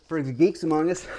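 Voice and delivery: laughing through the words; chuckling at own joke. Real, not acted. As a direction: giggle as you say it.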